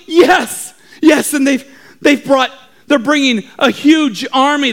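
high, urgent report; alarmed lookout. Acted. Lookout reports the coming army, alarmed. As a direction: stammer out the alarming news. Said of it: "he began to scream".

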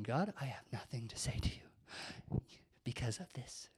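soft, half-whispered grumbling prayer; muted complaint. Retold or imitated. Retold sulky complaint to God.

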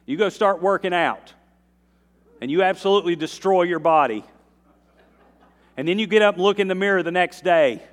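loud, high, driving preacher's build; full-force preaching. Acted. Pastor urging the church, loud preaching. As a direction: preach it out to the congregation.

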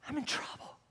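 breathy whisper of dread. Acted. Preacher voicing a moment of guilt, whispered. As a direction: whisper it like you just got caught. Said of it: "where it's like".

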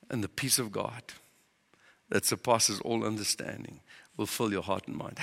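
low, soft, hushed recitation of the verse; soft scripture reading. Acted. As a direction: lay the verse down gently.

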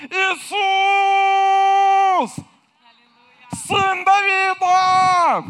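long, high, loud drawn-out cry. Acted. Blind man calls out to Jesus, a high loud pleading cry. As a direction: call across the crowd with all you've got. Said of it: "Знаете, что он им сказал".

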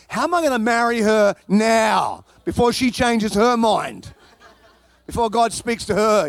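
can't wait to marry her; hammed-up giddy rush; excited. Retold sudden eager thought.

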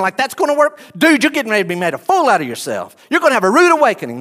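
high, loud, fast scoffing at the healer; jeering mourners laughing him off, loud and quick; mocking crowd. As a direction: sneer at him like a heckler who knows better. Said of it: "I think that's what they're thinking".

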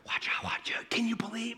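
hushed, breathy gossip among the crowd; whispered chatter. Acted. People leaving synagogue gossip to each other, in whispers. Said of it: "there's this chatter".

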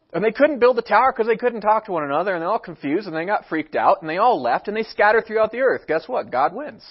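normal talking voice

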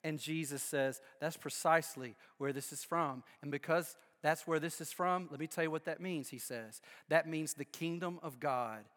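preacher quietly states the sermon's conclusion; solemn hush. Acted.

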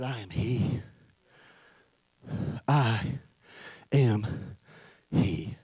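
low, soft, halting delivery; solemn preaching. Real, not acted. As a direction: let each phrase land with long pauses. Hushed, weighty preaching.

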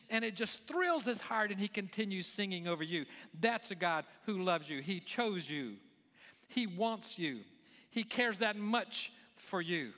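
high-pitched, driving sermon delivery; impassioned preaching. Real, not acted. Preacher telling listeners they are God's masterpiece, impassioned preaching. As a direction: build it up and keep pressing it home.

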